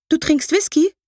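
high incredulous gasp of a question; astonished. Acted. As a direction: she can't believe her aunt drinks whisky. Niece discovers aunt's whisky.

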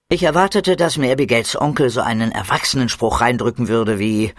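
ordinary delivery, nothing marked

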